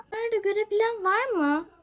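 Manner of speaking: fake-cry so the child hears you. Crying on purpose so the lost child hears her. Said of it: "Bir ağlayayım da sesimi duysun".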